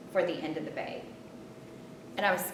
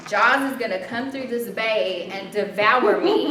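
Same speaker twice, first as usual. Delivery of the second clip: laughing; 8 dB louder, slower than usual. Retold or imitated. Retold panic, laughing.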